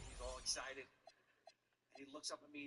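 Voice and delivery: usual speaking voice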